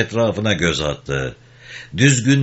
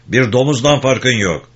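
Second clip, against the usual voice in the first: raised, mildly stern rebuke; scolding; 4 semitones higher, 4 dB louder than usual. A narrator's reading. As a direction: tell off with measured severity. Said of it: "diye çıkıştı". (yükselen sesle azarlama)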